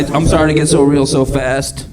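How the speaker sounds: ordinary talk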